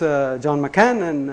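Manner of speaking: ordinary talk, nothing marked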